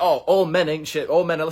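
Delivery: high, loud mocking parody; mocking impression. Vlogger mocking women who say men ain't shit, high sneer. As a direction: sneer it like a bitter online rant. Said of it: "for all women to say, like".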